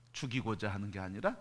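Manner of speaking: normal talking voice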